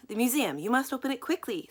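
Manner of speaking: a lightly voiced squeak; urgent squeaky plea. A narrator's reading. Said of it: "squeaked Mister Lowe".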